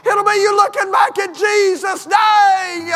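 high, loud preaching that builds to a shout; preacher calls the congregation back to the cross, building to full shout; preaching at full force. Acted.

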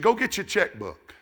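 loud, high command; retold fatherly order. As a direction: call out an order to his wife. Father ordering Mama in story.

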